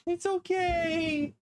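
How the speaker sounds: soothing the dog. Real, not acted. Comforting dog scared of thunder.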